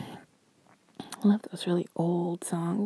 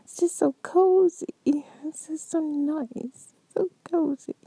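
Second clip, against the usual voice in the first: higher dreamy whisper; a cozy whisper at bedtime; 8 semitones higher, slower than usual. Acted. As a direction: sigh it out, cozy and content. Whispered ASMR roleplay.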